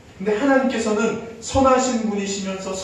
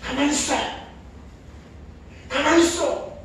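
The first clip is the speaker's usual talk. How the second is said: mock yell; 7 semitones higher than usual. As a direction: snap at the kid like a stressed mom. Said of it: "엄마가 소리를 질러요". (아이에게 소리치는 엄마의 호통)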